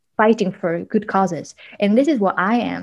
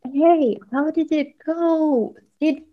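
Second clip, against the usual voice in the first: high, slow, worried asking; mock anxious pleading in a raised voice; 9 semitones higher, slower than usual. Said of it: "So I was, was like".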